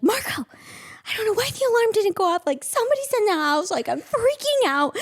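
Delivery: high, fast, hammed-up alarm; panicking. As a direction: re-enact a frantic middle-of-the-night panic. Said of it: "I'm, like, panicking".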